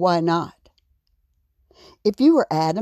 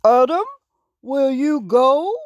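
higher, pushed-out appeal; lightly voiced king; 6 semitones higher, 5 dB louder than usual. A narrator's reading. King asks little raccoon to face the giant, calling higher. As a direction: put the question to him keenly, pitched up.

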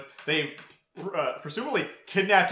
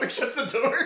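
normal talking voice

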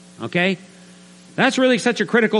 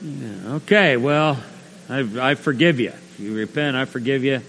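reluctant forgiveness; 5 semitones lower than usual. Acted. Punched coworker forgives the new believer, reluctant. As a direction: give in grudgingly, voice dropped. Voices victim grudgingly forgiving.

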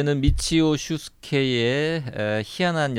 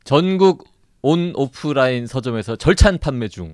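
put-on louder old-style sales pitch; chipper 1950s ad; 5 dB louder than usual. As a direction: close out like a radio ad. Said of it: "옛날에 광고하는 것처럼".

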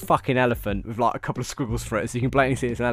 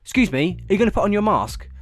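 higher, louder, snippy salesman demand; mock the guy's rude tone; snotty attitude; 8 semitones higher, 6 dB louder than usual. Mimicking the maskless salesman, snotty and louder. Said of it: "with an attitude as well".

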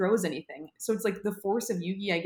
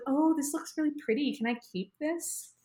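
lightly voiced girl asking sweetly, a bit higher; mock-sweet higher girlish plea, slowing at the end; Anzu's ask; 8 semitones higher than usual. Anzu asking Yugi for the card, mock-sweet higher voice.

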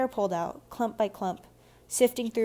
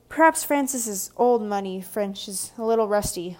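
teasing; 6 dB louder than usual. A narrator's reading. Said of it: "with a wink in Tabitha's direction".

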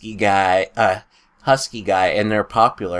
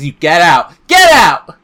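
shouting; 7 semitones higher, 10 dB louder than usual. Acted.

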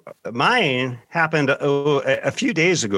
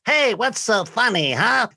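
put-on high, squeaky five-year-old demanding an answer; squeaky little kid; 8 semitones higher than usual. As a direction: play a cheeky little boy with fists on hips, pitched way up. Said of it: "he's got kind of a squeaky voice".

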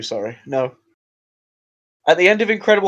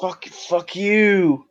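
slow, stretched-out "Fuck, fuck you" in a put-on voice; drawn-out mock insult; 3 semitones higher, 6 dB quieter, much slower than usual. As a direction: drag "fuck you" out playfully.

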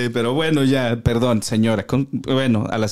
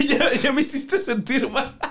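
slow, laughing and higher; 8 semitones higher, 3 dB quieter, slower than usual. Real, not acted. Friend apologising for laughing, still giggling. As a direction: giggle through a guilty apology.

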